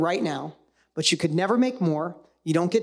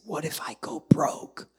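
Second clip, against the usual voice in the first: fearful whisper; 2 semitones lower, slower than usual. Retold or imitated. Voicing an audience member's fear.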